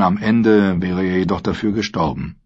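plain reading voice

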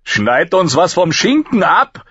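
high, slow, gleeful heckle; jeering call. A narrator's reading. Rast heckles during the beating, calling out gleefully. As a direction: call out a taunt from the onlookers. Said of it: "rief Rust lachend".

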